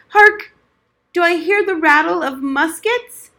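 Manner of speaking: sudden high loud call of Hark; startled alarm at imagined gunfire; excited old veteran. Acted. Old soldier hears imagined battle.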